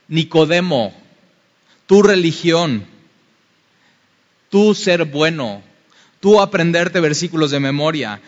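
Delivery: loud, rising sermon climax; full-force preaching. Real, not acted. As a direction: preach it with fire to the crowd. Predicador a plena fuerza. (predicación fervorosa y fuerte)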